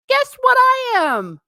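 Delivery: high, loud, slow teasing question; teasing excitement. Acted. Announcing her subscriber count to the chat, high teasing excitement. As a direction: build suspense for the big news.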